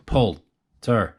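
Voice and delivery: Poltergeist said slowly, each syllable split apart. Shown as a demonstration. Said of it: "How many syllables?".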